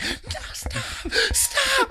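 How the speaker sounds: high, voiceless squeak; mock panicked plea. Girl hearing him call the cops, whispery mock panic. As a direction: imitate a small girl begging in panic.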